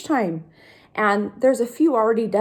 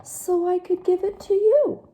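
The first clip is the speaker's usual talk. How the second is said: higher, gentle duckling; sweet duckling; 8 semitones higher than usual. Acted. Duckling tells pigeon the cookie is for him, high. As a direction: kindly explain.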